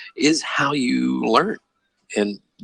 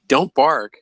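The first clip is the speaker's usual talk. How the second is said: louder, higher scolding of a dog; mock scolding; 6 semitones higher, 6 dB louder than usual. Wife telling the dog off, loud mock scolding. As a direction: give the dog a firm, raised-voice order. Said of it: "The wife would yell at the dog".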